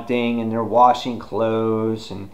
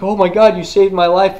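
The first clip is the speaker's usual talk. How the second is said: higher, louder overexcited thanks; gushing mock relief; 9 semitones higher, 6 dB louder than usual. Donna thanks her rescuer, high loud mock gushing. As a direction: gush in a high, loud imitation.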